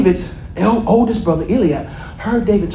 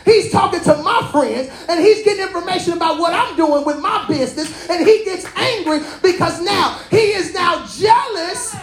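full-force preaching; 8 semitones higher than usual. Acted. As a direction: drive the message home to the congregation.

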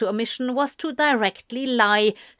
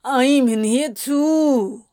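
slow, loud, higher-pitched whine; yowling; 4 semitones higher, 4 dB louder, slower than usual. A narrator's reading. Anthony reminding them he's trapped too, yowling. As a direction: wail it, stretching the words. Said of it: "Anthony yowled".

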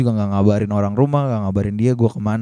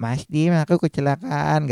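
higher, put-on plaintive girlfriend; lightly hammed-up accident report, pitched up; exaggerated victim tone; 4 semitones higher, 4 dB quieter than usual. Narrator mimicking Niken reporting her crash, exaggerated plaintive tone. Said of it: "Ngomongnya nadanya nggak kayak tadi ya".

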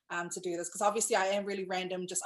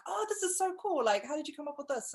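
high, impressed excitement; excited; 6 semitones higher, 3 dB quieter than usual. Retold or imitated. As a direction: gush about the idea. People's reaction quoted.

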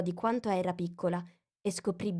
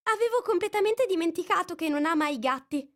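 Alice voice; 11 semitones higher, 4 dB louder than usual. A narrator's reading. Alice puzzles over the mouse. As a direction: wonder to yourself.

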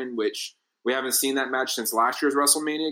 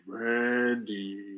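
slow, drawn-out demon call; imitated demonic voice, softly drawn out; demonic deep voice; 4 dB quieter than usual. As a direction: stretch the name out like a demon. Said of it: "with this demonic, deep voice".

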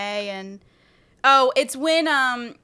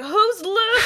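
high, loud quoted question; hammed-up movie bafflement; mock baffled yell; 9 semitones higher, 6 dB louder than usual. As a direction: blurt it in confusion, laughing. Groggy coach's baffled reply.

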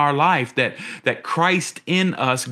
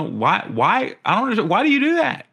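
higher and fast, baffled and pleading; preacher voicing Jesus in high, disbelieving exasperation; exasperated Jesus; 7 semitones higher than usual. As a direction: ask it as if truly bewildered by them. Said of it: "I think he's like".

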